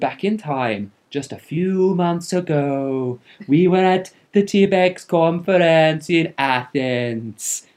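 slow, drawn-out faux Arabian-Welsh accent; hammed-up mystery foreign accent. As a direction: put on a muddled Arabian-Welsh accent, stretching the words. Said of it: "I'm not sure what country that accent was supposed to be".

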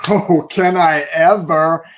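loud, slow, cocky voice; smug eager retort. Retold or imitated. Husband boasting he can show the pastor, smug and loud. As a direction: gloat like he's already won.

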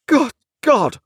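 high, loud despairing cry; despairing exclamation, pitched right up; desolate desperation. A narrator's reading. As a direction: cry out at the end of your tether. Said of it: "outbreak of desolate desperation".

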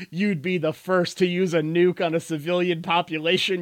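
mock righteous indignation, high and loud; hammed-up heroic condemnation; Captain America. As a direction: denounce the villain in outrage. Acts Captain America scolding villain.

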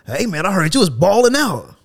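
mock his hearty attempt at slang; imitated manager. Plant manager praises a worker in slang, mock chummy voice.

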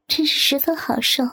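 plain reading voice

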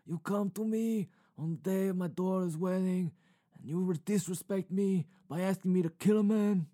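a hint of Brando's Godfather; mild put-on mob boss; Marlon Brando impression. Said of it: "That's my Marlon Brando".

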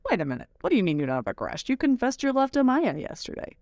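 fast, playful call-out that climbs high; half-joking mom exposing his secret; teasing reveal. Retold or imitated. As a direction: blurt it out to tease him in front of the family. Teasing son in front of relatives.

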